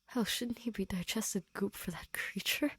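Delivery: ordinary delivery, nothing marked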